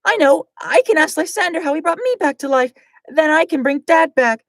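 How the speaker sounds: put-on high-pitched girl hero, determined; high Glacia voice. Glacia vowing to bring her dad back, high voice.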